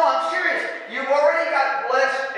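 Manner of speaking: usual speaking voice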